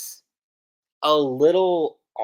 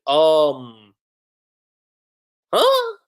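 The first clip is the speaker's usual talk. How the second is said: confused; 5 dB louder than usual. Retold or imitated. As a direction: act puzzled, pitch leaping up at the end. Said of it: "Lucy gets a bit confused".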